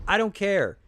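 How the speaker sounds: loud, high I don't care; defiant outburst. Real, not acted. Retorting to a critic, loud and defiant. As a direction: shout it back, won't hear it.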